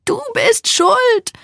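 slow, high-pitched accusing cry; crying scream. A narrator's reading. Joey starts bawling and blames her friend, screaming. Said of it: "schreit sie".

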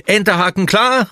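high-pitched call. A narrator's reading. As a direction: sing out the report across the deck. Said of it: "ruft Moritz".

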